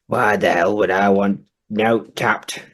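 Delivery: usual speaking voice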